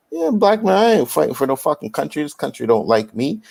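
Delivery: high, defiant voiced old-timer; older generation voice. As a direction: toss it off defiantly.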